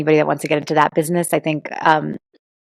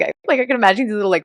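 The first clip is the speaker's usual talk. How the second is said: ordinary talk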